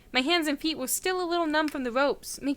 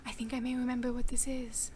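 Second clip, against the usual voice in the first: hushed whisper to herself; 7 dB quieter than usual. Real, not acted. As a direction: whisper it under your breath. Whispered aside.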